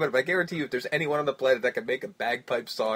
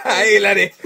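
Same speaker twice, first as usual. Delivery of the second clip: mock Scottish; 5 semitones higher, 12 dB louder than usual. Scottish banter continues.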